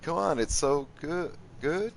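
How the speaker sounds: high, coaxing tease; mock-pleading high voice; high teasing voice. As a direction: coax them in a high playful voice. Teasing viewer about quinoa.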